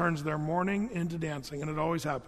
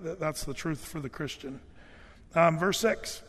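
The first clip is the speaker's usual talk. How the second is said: ordinary talk, nothing marked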